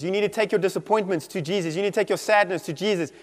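ordinary talk, nothing marked

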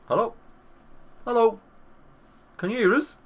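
imitating dad calling high down the line; dad's voice. Dad calling his son through the Alexa, mock voiced. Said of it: "me dad's voice".

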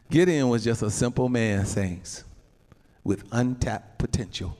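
usual speaking voice

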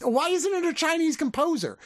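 high-pitched, baffled complaint; exasperated. Real, not acted. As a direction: pitch leaps up in disbelief. Baffled complaint about the film's score.

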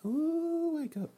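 squeaky high voice. Acted. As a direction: squeak it out playfully.

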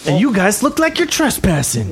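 put-on high, menacing young tough; gang leader. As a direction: stake your claim on the turf. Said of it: "the guy in the front walks up and goes".

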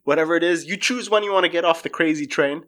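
normal talking voice

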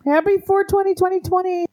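high, bright sing-song cheer; excited greeting. Real, not acted.

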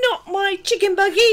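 put-on high indignant child voice; little girl's protest. Imitated girl refusing to share her buggy, high protest. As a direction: protest like a small kid, high and half laughing. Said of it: "And she goes".

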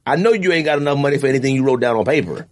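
ordinary talk, nothing marked

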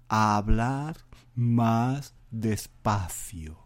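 very slow, drawn-out words; exaggeratedly slow. Shown as a demonstration. Podcaster demonstrating speaking slowly for listeners. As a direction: drag it out far too slowly. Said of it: "eso es demasiado despacio".

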